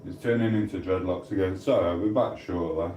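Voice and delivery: ordinary delivery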